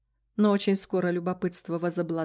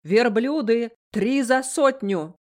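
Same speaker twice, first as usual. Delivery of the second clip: loud, slow market cry; vendor calling out; 3 semitones higher, 6 dB louder, slower than usual. A narrator's reading. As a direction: shout the price to the crowd. Vendor hawking camels.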